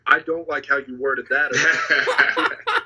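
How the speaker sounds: slow, laughing through the words. Real, not acted. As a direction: let the laugh spill into the line. Laughing at friend's reply.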